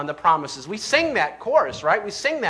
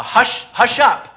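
higher, louder, slow command to hush; emphatic hushing; 6 semitones higher, 5 dB louder than usual. Retold or imitated. Preacher voices the rebuke to the wind, emphatic hushing. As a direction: imitate a forceful order for silence. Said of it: "this is emphatic".

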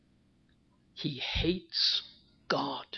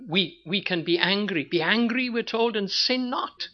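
ordinary talk

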